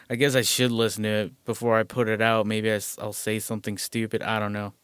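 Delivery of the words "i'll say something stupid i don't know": usual speaking voice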